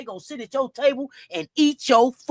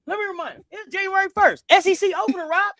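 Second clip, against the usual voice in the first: emphatic shout; 5 semitones higher, 7 dB louder, faster than usual. Real, not acted. Announcing emphatic reminder.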